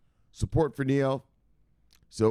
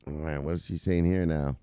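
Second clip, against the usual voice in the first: ordinary talk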